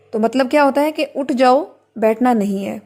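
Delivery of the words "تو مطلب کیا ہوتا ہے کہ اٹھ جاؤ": normal talking voice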